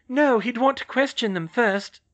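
high, loud interjection; cutting in. A narrator's reading. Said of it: "Hermione cut in".

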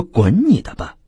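mild mock outburst; angry snap. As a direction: drive her off in anger. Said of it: "许大气急了".

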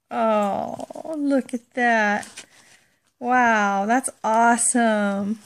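usual speaking voice

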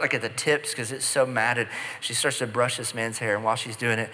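usual speaking voice

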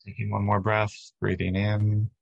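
unhurried, even meditation voice with long pauses; steady, calming body-scan delivery; guided meditation. Real, not acted. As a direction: guide listeners gently through breath and body.

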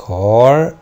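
كار said again loud; model word repeated. Shown as a demonstration. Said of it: "كلمة".

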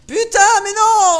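loud, high angry outburst; frustrated shout. Real, not acted. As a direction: yell at the game in frustration. Gamer dies, rages at game.

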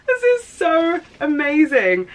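high, slow, drawn-out delight; gushing. Real, not acted. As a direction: gush over it, stretching the words. Gushing over gifted jumper.

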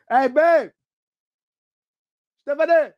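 loud call across the house, high. Real, not acted. Husband calling his wife from another room, loud high call. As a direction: shout for his wife who's out of earshot.

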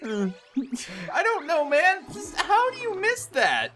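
laughing disbelief. Real, not acted.

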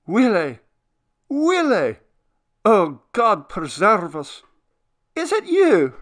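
put-on high, loud cry of an overwhelmed old man; stammering, deeply moved. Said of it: "It came out with a sort of stammering".

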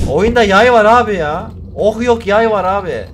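loud, high-pitched complaining; exasperated rant. Real, not acted. Gamer ranting about weapons without ammo, exasperated. Rants at useless game weapons.

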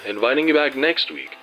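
ordinary talk, nothing marked

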